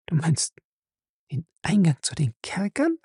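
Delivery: high, hushed frightened question; anxious whisper. Acted. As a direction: ask it under her breath, afraid. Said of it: "flüsterte sie ängstlich".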